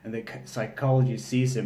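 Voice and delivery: ordinary talk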